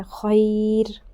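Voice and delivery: خیر drawn out very slowly, stopping on a thin ر; slow stopping form. Shown as a demonstration. Teacher showing the stop, slow drawn-out word. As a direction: stretch خیر and stop on a light ر. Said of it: "وقف کی حالت میں".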